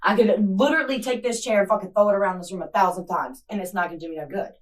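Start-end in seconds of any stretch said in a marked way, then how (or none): 0.0-1.9 furious shout. Real, not acted. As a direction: yell that she is done holding back. Mother refusing to chill out.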